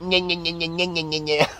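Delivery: mocking, soft nasal-style nagging noises; cranky whining. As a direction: imitate a bitchy little nya-nya. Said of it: "You're cranky, you're bitchy, you're snitchy".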